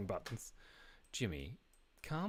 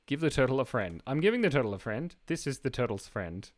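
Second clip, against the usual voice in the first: normal talking voice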